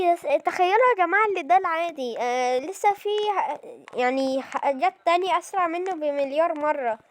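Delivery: ordinary talk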